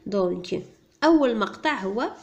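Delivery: ordinary delivery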